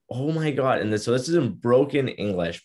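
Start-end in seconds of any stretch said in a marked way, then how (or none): 0.1-0.8 higher-pitched imitation of an amazed fan; mildly gushing retold exclamation; fangirling. Visitor from Iraq meeting a Humboldt Bronco, starstruck. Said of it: "absolutely fangirling".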